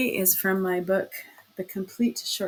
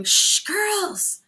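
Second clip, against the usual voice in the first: high, sharp shush; urgent high shush to the girls; loud warning; 12 semitones higher, 4 dB louder than usual. Acted. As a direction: hush the class loudly. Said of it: "she gave a loud warning".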